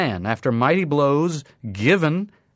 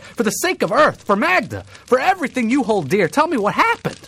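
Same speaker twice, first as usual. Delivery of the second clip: high, loud urgent pleading; desperate plea; 10 semitones higher, 5 dB louder than usual. A narrator's reading. Narrator begging a dying man for news, urgent and loud. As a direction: beg him to speak before he dies.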